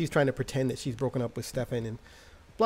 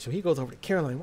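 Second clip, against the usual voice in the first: ordinary delivery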